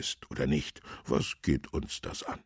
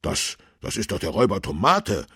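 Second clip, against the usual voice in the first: higher, louder, quick stammered start; excited call; 4 semitones higher, 7 dB louder, faster than usual. A narrator's reading. Policeman spots the fleeing robber, excited call. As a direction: blurt it out in excited recognition. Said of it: "rief Tobias Kern aufgeregt".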